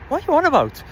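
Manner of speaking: high-pitched, exasperated comeback; indignant retort. Retold or imitated. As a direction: throw it back in disbelief, pitched right up. Retold baffled retort to the shouter.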